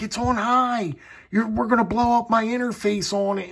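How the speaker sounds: high-pitched mock holler; retold hollering. Husband hollers to wife as flashlight heats up, high mock yell. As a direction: call it out high and urgent, half-joking. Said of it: "I'm hollering".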